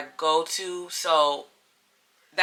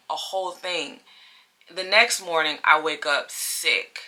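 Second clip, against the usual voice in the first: normal talking voice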